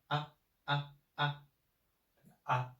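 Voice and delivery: ah very short and slight; just a quick light ah; short neutral tone. Shown as a demonstration. Teacher showing the toneless short syllable. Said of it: "This is a very short pronounce".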